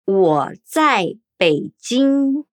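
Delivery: syllable by syllable; 我在北京 said slowly as wǒ zài Běijīng; slow Mandarin demo. Shown as a demonstration. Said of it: "Eu estar em Pequim".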